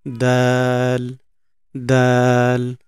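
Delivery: "দাল" held long on a level note; drawn-out letter name. Shown as a demonstration. Teacher names the letter shown, "দাল" drawn out long. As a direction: stretch "দাল" slowly and evenly. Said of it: "দেখেন, এটা হচ্ছে".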